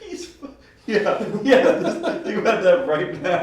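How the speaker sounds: laughing through the words; high, loud laughing talk. Real, not acted. Laughing while telling.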